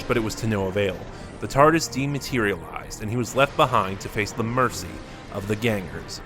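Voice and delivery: ordinary delivery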